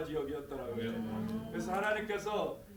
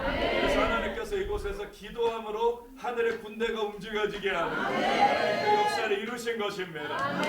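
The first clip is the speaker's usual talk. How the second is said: louder, higher preaching voice; raised pulpit declaration; fervent preaching; 5 semitones higher, 7 dB louder than usual. Real, not acted. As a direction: proclaim it to the whole crowd. Preaching rises to full force.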